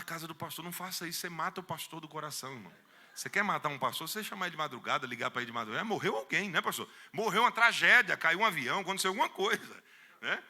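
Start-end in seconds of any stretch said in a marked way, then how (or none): 5.8-10.4 higher, louder panicked guesses; hammed-up fright; mock alarm. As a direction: play the startled pastor's fears. Mock alarm at midnight call.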